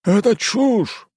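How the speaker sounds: high, slow furious shout. Acted. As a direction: yell the denial outraged. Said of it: "прокричал управляющий".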